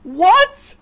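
high, loud disbelieving yells; shocked yelling. Real, not acted. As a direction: shout in total disbelief. Shocked by alien count.

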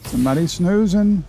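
ordinary talk, nothing marked

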